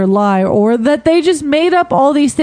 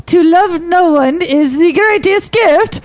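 slow, hammed-up Russian accent; lightly hammed-up Russian accent; 4 semitones higher, slower than usual. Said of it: "you gotta read it like a, like a Russian Nazi".

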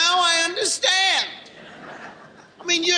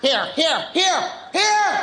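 parental barrage of heres; 2 semitones higher, slower than usual. Acted. Parent summoning a child, escalating calls. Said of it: "Sound like a tobacco auctioneer".

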